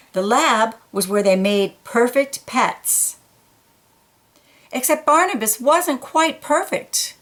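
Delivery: expressive read-aloud storytelling; story narration. A narrator's reading. Reading a picture book to children, expressive narration. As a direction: tell it warmly to young listeners.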